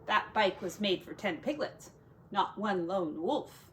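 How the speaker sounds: ordinary delivery, nothing marked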